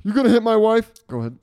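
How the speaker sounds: loud, high mock outrage; mock confrontation. Friend mock-challenging the storyteller over a slap, indignant.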